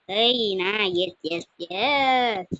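loud, drawn-out yeses; excited cheer. Real, not acted. Player cheering in the game, excited.

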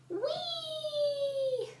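very high delighted squeal; gleeful whee. A narrator's reading. Goldilocks squealing with delight on the rocking chair. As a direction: ride the rocking chair with glee. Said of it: "cried Goldilocks".

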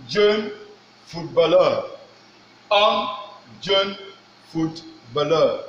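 syllable by syllable "un jeune footballeur"; French pronunciation. Shown as a demonstration. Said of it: "this one says".

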